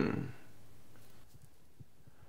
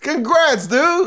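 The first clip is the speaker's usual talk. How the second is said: excited; 10 semitones higher, 4 dB louder, much slower than usual. Acted. Congratulating friend on audition.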